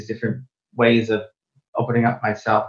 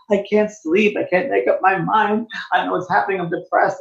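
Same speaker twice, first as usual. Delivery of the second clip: retold crying client; 7 semitones higher than usual. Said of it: "and she was crying".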